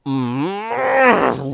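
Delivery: loud, high growling roar; angry roar. A narrator's reading. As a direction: roar wordlessly in rage. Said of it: "roared Don Camillo".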